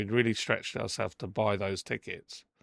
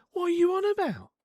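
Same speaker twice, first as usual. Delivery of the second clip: high-pitched, indignant challenge; indignant retort; 3 dB louder than usual. Retold or imitated. Telling off the woman who complained, indignant. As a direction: throw it back in high-pitched disbelief. Acted quarrel with accusing woman.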